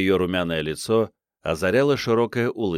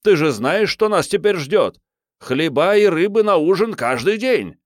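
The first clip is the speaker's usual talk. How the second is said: бодрый конферансье; 10 semitones higher, 5 dB louder than usual. Acted. Комик шутит со сцены.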